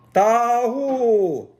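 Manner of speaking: high, loud long calling cry; shepherd's call. Acted. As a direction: call the flock away to water. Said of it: "Jabal called".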